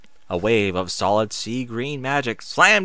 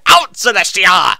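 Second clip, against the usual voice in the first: high, loud scream; 8 semitones higher, 11 dB louder than usual. Acted. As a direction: order her out at full voice.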